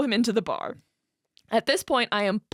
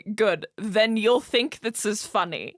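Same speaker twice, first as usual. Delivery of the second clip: ordinary talk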